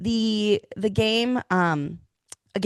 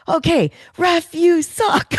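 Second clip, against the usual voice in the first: loud, high-pitched heckle at the ref; imitating mom's yell; 11 semitones higher, 6 dB louder, slower than usual. Daughter imitates her mom yelling at the ref, high mock shout. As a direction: belt it out as a hammed-up outraged mom. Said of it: "she saw that call and she goes".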